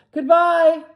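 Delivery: high, loud farewell call; chorused farewell. A narrator's reading. Animals calling farewell to Boxer, loud chorus. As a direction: call it out together. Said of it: "they chorused".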